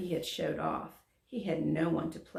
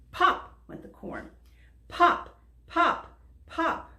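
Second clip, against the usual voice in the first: popping sounds; 11 semitones higher, 5 dB louder, slower than usual. A narrator's reading.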